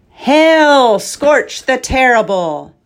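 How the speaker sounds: loud formal hail, stiff and even; a knight's flat ceremonial call; called out flatly. Acted. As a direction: bellow the greeting without feeling. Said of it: "he finally called out flatly".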